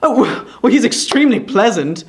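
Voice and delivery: high, loud fawning; obsequious cry. A narrator's reading. Brass fawning over insulting Quilp, obsequious. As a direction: cry out to please. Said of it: "cried the obsequious Samson".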